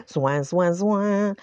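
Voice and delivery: playful sung squeeze-box "zoin"; hummed instrument imitation; accordion noise. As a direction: mimic the accordion wheezing.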